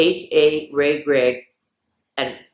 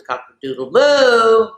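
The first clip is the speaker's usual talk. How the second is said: higher, put-on silly voice on moo; silly punchline; 6 semitones higher, 4 dB louder than usual. Repeating the joke for the kids in a goofy voice.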